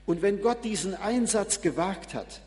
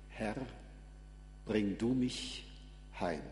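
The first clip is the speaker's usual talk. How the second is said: quiet, lower prayer with reverent pauses; closing prayer; 5 semitones lower, 8 dB quieter than usual. Real, not acted. Preacher thanking and asking the Lord to bring him home, hushed prayer. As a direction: pray softly, phrase by phrase.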